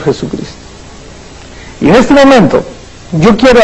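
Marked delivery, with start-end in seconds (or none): 0.0-0.5 whispered with reverence; hushed whisper. Real, not acted. Preacher naming the Lord Jesus Christ to the congregation, reverent whisper. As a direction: breathe the name in a whisper.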